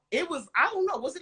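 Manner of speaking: usual speaking voice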